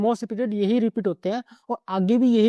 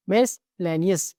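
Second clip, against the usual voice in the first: Miscellaneous said slowly, each syllable clear; teacher stressing the letters of miscellaneous; slow demo; much slower than usual. Shown as a demonstration. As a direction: spell miscellaneous out slowly for the class. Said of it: "देखो double C".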